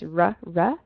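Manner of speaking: "R" as a plain English ruh. Shown as a demonstration. Teacher contrasts languages for students, English R sound. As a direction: say "R" the English way, tongue untrilled. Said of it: "In English, we say R".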